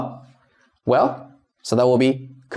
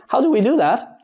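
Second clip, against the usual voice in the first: ordinary talk, nothing marked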